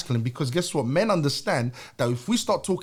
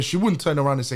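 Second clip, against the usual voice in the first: normal talking voice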